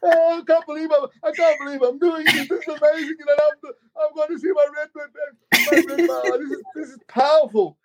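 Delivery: high, tearful crying voice. Retold or imitated. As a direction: sob it out, overwhelmed with joy. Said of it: "And I'm in this little Fiat crying".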